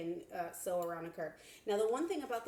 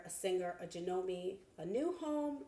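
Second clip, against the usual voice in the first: normal talking voice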